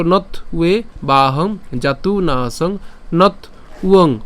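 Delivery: slow recital with "নথ" split from "উয়ে"; separated pronunciation. Shown as a demonstration. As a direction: pause between "নথ" and "উয়ং". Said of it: "আর আলাদা করবো".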